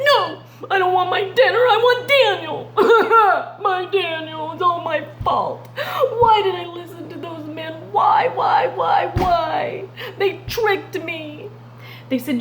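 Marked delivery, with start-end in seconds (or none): 0.0-2.7 high, loud, half-laughing wail; distraught king wailing for Daniel. Acted. King refuses dinner, grieving. 2.8-3.6 sobbing laugh. Acted. King grieving aloud. 3.6-7.7 lamenting king. Acted. King laments his mistake. 7.9-11.5 grieving king shouting why; anguished cry. Acted. King cries out in grief.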